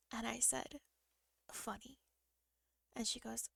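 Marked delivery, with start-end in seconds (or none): 1.5-2.0 a faint, much softer single word; very quiet. Retold or imitated. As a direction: barely breathe it out in pain. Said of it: "'cause I'm talking really quiet".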